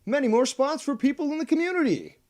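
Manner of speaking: high, loud hyped announcer; excited announcer. Acted. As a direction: gush like a cheery TV host. Upbeat news-style segue.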